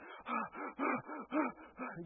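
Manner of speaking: a long mock gasp of calming down; imitated gasp. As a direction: draw in a slow, exaggerated gasp.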